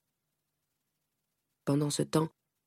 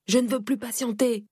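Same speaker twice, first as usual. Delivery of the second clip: higher, louder outburst near tears; tearful cry; 6 semitones higher, 3 dB louder than usual. Acted. Young wife crying out to her family, higher and louder. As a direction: cry it out, done enduring. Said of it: "criai-je, éclatant en sanglots".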